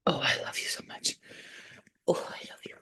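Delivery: soft whispered love; tender whisper. Real, not acted. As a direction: breathe it to your dog. Whispering to dog.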